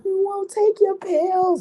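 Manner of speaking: very high, slow, squeaky mock sob; mock crying voice. Spoiled daughter crying at her dad over pills, squeaky mock sob. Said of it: "I bust out crying".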